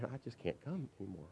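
confessing. Retold or imitated. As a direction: say it like a reluctant confession. Student's reluctant confession.

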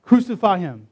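a narrator's measured version of a mob's shout. A narrator's reading. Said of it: "they kept shouting".

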